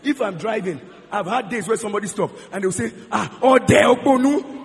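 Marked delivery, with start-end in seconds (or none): 3.1-4.6 loud, drawn-out, higher Yoruba jeer; mock roadside insult. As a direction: imitate a passerby yelling abuse, slowly. Stranger insults him in Yoruba.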